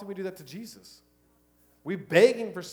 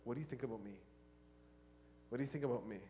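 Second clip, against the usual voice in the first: much softer, lower gentle question; quiet God voice; 6 semitones lower, 18 dB quieter, much faster than usual. Retold or imitated. Preacher voicing God asking a seeker softly. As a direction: ask it hushed and tender. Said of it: "And he'll tell you".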